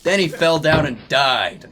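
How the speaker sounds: ordinary talk, nothing marked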